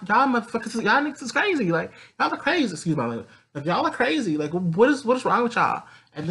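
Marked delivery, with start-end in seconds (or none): 0.1-2.7 very high, incredulous squeal of protest; high-pitched exasperation. Acted. Salem telling off the gods, high and exasperated. 3.6-5.8 high, incredulous scolding; high-pitched exasperation. Acted. Salem asking the gods what's wrong, high and exasperated.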